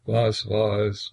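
dazed and slow, to himself; slow senseless repeat. Acted. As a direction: drag it out, mind elsewhere. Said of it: "wiederholte der Zarewitsch ganz sinnlos vor sich hin".

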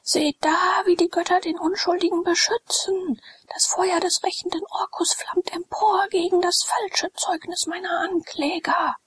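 high, solemn prophetic proclamation; thundering voice. A narrator's reading. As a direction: proclaim divine judgment like a dark lord. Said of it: "rief er mit donnernder Stimme".

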